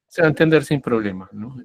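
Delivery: ordinary talk, nothing marked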